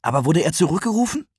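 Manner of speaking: put-on high, fast, excited child; eager questioning. A narrator's reading. Eager child presses postmaster.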